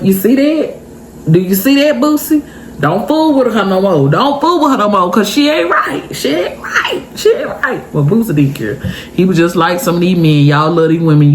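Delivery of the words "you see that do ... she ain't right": high-pitched, disapproving coaching; playful high-voiced indignation; mock warning. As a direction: warn your dog off her, pitch climbing as you repeat it. Acting out warning Bootsy about her.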